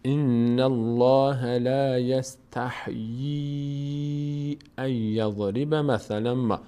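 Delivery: slow recitation, long-held yee in "یستحیی"; long madd recitation. Shown as a demonstration. Teacher models the verse with its stretch, slow recitation. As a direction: recite slowly, stretch "یستحیی" as yas-tah-yeee. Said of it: "بعد اینو باید بکشیم".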